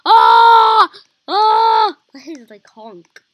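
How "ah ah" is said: loud, high excited yell; gleeful loud shouting. Real, not acted. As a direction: shout it out with glee. Excited big-head moment.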